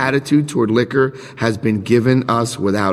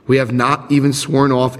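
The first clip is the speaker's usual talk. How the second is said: ordinary delivery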